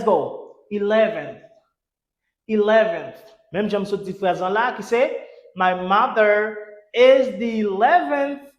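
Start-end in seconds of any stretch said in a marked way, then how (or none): 5.6-8.5 slow, deliberately spaced English words; slow model reading. Shown as a demonstration. Teacher returning to the example sentence for learners, slow model reading. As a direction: take the sentence word by word.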